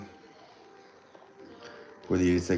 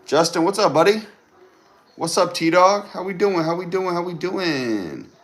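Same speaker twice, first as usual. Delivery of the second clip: high, loud hype greeting; excited greeting; 11 semitones higher, 7 dB louder than usual. Real, not acted. Greeting viewers on the live stream, high and loud.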